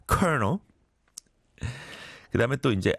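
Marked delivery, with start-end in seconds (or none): 0.1-0.6 colonel said /knol/, like kernel; "colonel" rhymes with kernel, no L heard; 커널처럼 발음; correct colonel. Shown as a demonstration. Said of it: "But you pronounce it".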